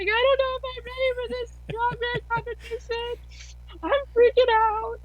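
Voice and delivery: retelling a panicked moment at Bible study, high and teary; high panicky wail. Retold or imitated. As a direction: voice shoots way up, near tears. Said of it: "I'm in my Bible study and I'm crying".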